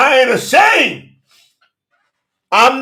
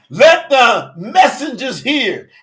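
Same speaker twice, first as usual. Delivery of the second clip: ordinary delivery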